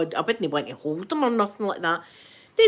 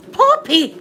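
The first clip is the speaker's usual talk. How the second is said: high, loud call across the room; calling the dog; 9 dB louder than usual. Real, not acted. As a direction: shout the dog's name.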